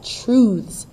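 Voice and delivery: strong stress on TRUTHS; stressed syllable. Shown as a demonstration. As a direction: punch TRUTHS with a high, falling tone.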